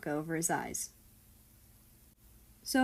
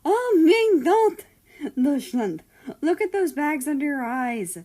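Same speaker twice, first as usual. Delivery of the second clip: much higher, louder fretful cry; fussing; 8 semitones higher, 9 dB louder than usual. A narrator's reading. Worried father fussing over his tired son, loud and high. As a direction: fuss loudly like a worried mother. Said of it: "Nazi fussed".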